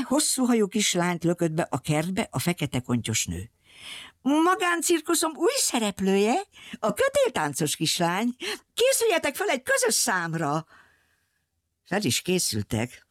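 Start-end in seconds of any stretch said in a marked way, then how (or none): 4.2-10.7 high-pitched ringmistress presenting a new act; circus owner's announcement. A narrator's reading. Circus owner presents the rope-dancing girl, high announcing voice.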